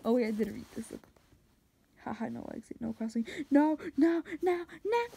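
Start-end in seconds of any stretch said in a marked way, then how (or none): 3.5-5.0 high, loud repeated refusal; hammed-up pitched-up objection; mock protest. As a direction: fire off each word as a separate cry. Mock refusal at own old drawing.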